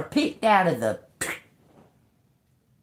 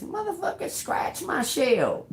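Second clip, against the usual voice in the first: defiant crab; 10 semitones higher, 3 dB quieter than usual. Retold or imitated. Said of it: "that little bitty crab just looks at him and says".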